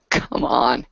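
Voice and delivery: normal talking voice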